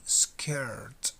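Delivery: Skirt, the final T sounded low and clear; Skirt, T clearly released. Shown as a demonstration. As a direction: finish Skirt on a clean T. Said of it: "Final T".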